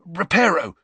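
high, sudden spell command; hasty. A narrator's reading. Boy mending the shattered bowl, hasty. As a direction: blurt the spell out urgently. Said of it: "he said hastily".

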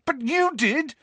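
high, heated retort; angry. A narrator's reading. Harry rounds on the ghost, angrily. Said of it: "said Harry angrily".

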